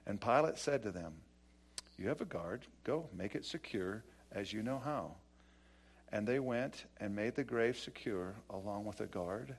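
quiet, measured reading of the passage; scripture reading. Acted. Pastor reads the Gospel passage to the congregation, hushed. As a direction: read the verses softly and evenly.

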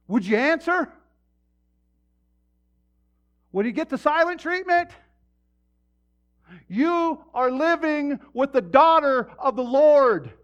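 full-force shout, pitched high and loud; impassioned preaching. Acted. Preacher confronting husbands who mistreat their wives, impassioned shouting. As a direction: thunder it at the congregation in high, ringing bursts.

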